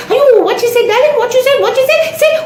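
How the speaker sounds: high-pitched mock-scandalised auntie; shriek-high, repeated disbelief, played up; astonished grandmother. Grandmother questioning the child's words, mock astonishment. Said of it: "And grandmother is saying".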